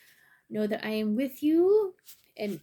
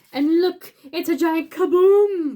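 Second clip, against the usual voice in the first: loud, higher, slow dramatic build; excited kaboom; 6 semitones higher, 12 dB louder, slower than usual. Acted. Narrating the giant apple crash, loud and excited. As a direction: make the crash huge.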